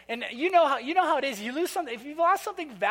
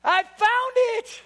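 much higher, louder, slow triumphant shout; joyful shout of relief; excited discovery; 13 semitones higher, 9 dB louder, much slower than usual. Retold or imitated. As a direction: yell it from the next room in triumph. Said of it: "from the office goes".

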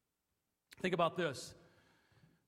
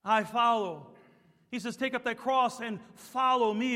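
loud, higher fervent preaching; rising sermon fervor; full-force preaching; 6 semitones higher, 7 dB louder than usual. Acted. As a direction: preach it with conviction.

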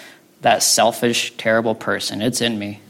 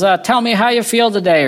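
high, louder-than-usual welcoming question; imitated church greeter; 10 semitones higher, 4 dB louder than usual. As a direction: greet the congregation in a raised, lilting voice.

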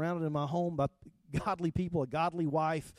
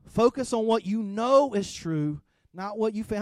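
louder and higher, pressing the word know; louder emphatic repeat; 4 semitones higher, 5 dB louder than usual. Acted. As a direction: drive the maxim home to the congregation. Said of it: "repeats the maxim louder".

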